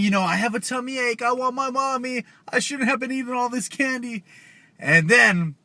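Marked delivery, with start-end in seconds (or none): 0.2-4.2 high mock-crying child voice; crying kids. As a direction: whine it like an overfed, tearful kid. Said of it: "And they're crying".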